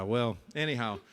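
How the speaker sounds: ordinary talk, nothing marked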